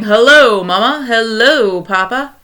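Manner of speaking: lightly mocking, slow sing-song hellos; mocking radio men. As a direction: tease the broadcast a little. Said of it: "men ... saying".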